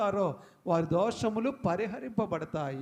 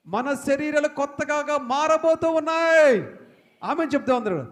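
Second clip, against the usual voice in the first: loud, higher proclamation of new bodies; proclaiming loudly; 6 semitones higher, 8 dB louder than usual. Real, not acted. Preacher to congregation, loud proclaiming. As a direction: ring it out to the whole hall.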